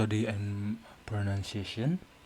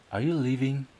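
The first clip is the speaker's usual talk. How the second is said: Are you leaving? asked as a real question; asking intonation; 4 semitones higher, 7 dB louder, faster than usual. Shown as a demonstration. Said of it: "That's a question".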